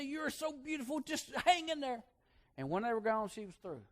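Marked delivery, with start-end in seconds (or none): 0.0-2.0 put-on much higher, gentle old lady's encouragement; tender old aunt. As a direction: comfort the girl like a doting old aunt. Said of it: "she say".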